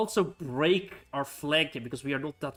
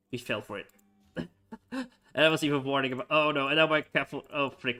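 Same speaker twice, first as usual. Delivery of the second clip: ordinary talk, nothing marked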